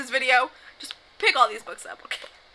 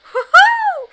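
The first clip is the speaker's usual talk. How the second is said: mock cheer; 15 dB louder than usual. Mock cheer before crying.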